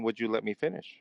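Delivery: ordinary delivery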